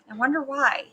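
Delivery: ordinary delivery, nothing marked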